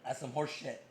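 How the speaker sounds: usual speaking voice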